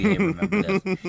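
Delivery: laughing mock quote. Mocking the movie's nostalgia.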